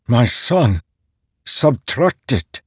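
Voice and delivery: the word breaks out of him in pain; father's anguished disbelief about his son; thick with emotion. A narrator's reading. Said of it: "his voice thick with emotion".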